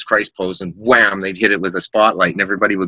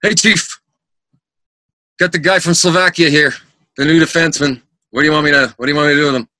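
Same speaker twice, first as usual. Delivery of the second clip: higher, raised voice calling to the boss; put-on announcing voice, pitched up; imitated veteran player; 6 semitones higher, faster than usual. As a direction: report to the chief as a prank. Veteran's prank on boss, acted.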